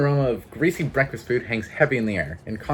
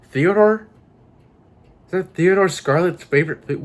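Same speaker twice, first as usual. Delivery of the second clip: high and louder, startled; sudden realization; 8 semitones higher, 6 dB louder than usual. Real, not acted. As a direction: it just clicked, say it aloud. Realizing the name.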